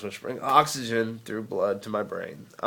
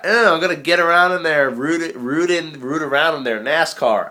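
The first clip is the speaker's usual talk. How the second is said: put-on Southern accent; 6 semitones higher, 7 dB louder than usual. Vlogger mocking a Southern doctor, hammed-up Southern accent. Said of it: "Southern accent's like".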